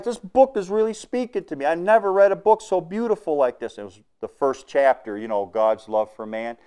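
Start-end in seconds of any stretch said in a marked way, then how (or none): 0.0-3.8 high, loud, overwhelmed voice; emotional. Retold or imitated. Hitchhiker telling the driver the book speaks to him, high emotional outburst. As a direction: gush it out, moved close to tears. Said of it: "he was so emotional".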